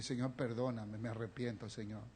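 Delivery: a frightened plea for forgiveness, very soft; whispered-down fearful repentance; scared apology. Retold or imitated. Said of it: "me dio un miedo y me asusté".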